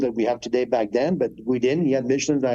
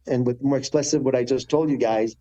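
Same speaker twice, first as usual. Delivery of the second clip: usual speaking voice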